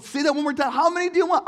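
high, loud disbelief; can't believe what dad just said; amazed kid. Retold or imitated. Kid asking dad to repeat the offer, high excited disbelief.

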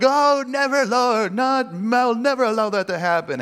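loud, high-pitched hammed-up refusal; mock-horrified outcry, hugely overplayed; overdramatic protest. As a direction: wail it out like a melodramatic disciple. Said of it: "over dramatic, you know, response".

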